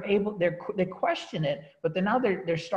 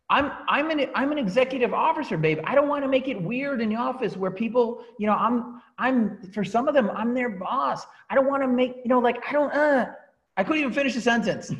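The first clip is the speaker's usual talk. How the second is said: flustered excuse; 7 semitones higher, 4 dB louder than usual. Retold or imitated. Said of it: "I go".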